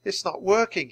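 usual speaking voice

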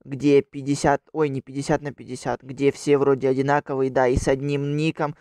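loud energetic channel greeting; YouTuber intro. Acted. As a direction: hype up the viewers. Вступление к видео. (бодрое приветствие ютубера)

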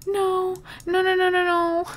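high, rising panic of no's; begging the pet not to go; distressed pleading no. Real, not acted. Gamer watching her pet die, pleading in high distress.

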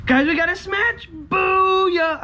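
shrieking jackpot winner. Real, not acted. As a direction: scream the win out. Scratch-off win, screaming with joy.

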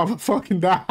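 ordinary talk, nothing marked

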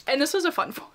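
normal talking voice

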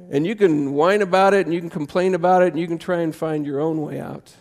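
ordinary talk, nothing marked

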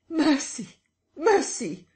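high, loud pleading scream; wild scream. Acted. Beaten invisible man screams to the crowd for mercy. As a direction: beg desperately for mercy. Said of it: "a wild scream of".

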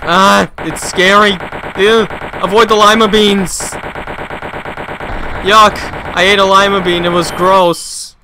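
loud, high, hammed-up disgust; mock horror. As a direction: recoil loudly in playful revulsion. Playful horror at lima beans.

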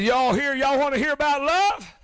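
imitated preacher call, climbing in pitch; rallying a crowd. Cousin asking the street crowd, rallying call.

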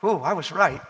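ordinary talk, nothing marked

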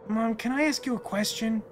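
a hint of a child's voice; lightly voiced wistful child. Child asking Mom about Dad, lightly voiced and tentative.

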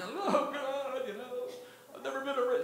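put-on high, tearful girlish wail, well above his voice; crying young girl. Arrested girl sobbing to the cops, high and tearful. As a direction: play a panicked young girl in tears. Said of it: "she's crying".

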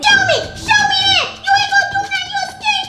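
taunting challenge. Acted. Kids' classroom quarrel, taunting.

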